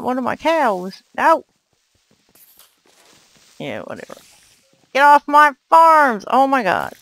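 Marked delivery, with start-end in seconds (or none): 4.9-6.9 angry shout. Real, not acted. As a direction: chase them off the farm with a shout. Mobs destroying his farm.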